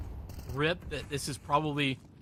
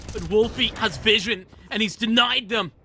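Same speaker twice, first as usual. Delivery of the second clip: excited play-by-play; 5 semitones higher, 5 dB louder than usual. Real, not acted. Big play called live.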